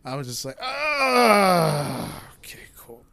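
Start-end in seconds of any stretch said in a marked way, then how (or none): 0.6-2.2 loud, high hammed-up moan; fake orgasm moan. Faking climax in bed with her, hammed-up loud moan. 2.4-3.0 breathy whispered afterthought; awkward whispered aside. Retold or imitated. Right after faking it, a sheepish quiet aside.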